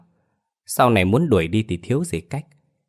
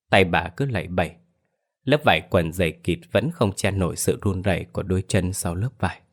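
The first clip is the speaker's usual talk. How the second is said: ordinary delivery, nothing marked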